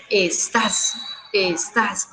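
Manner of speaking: "estás" repeated deliberately, weight on the final "tás"; stress on last syllable. Shown as a demonstration. Teacher showing chicos an aguda word, "estás" stressed on its last syllable. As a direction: lean on the end of "estás". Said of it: "es una palabra aguda".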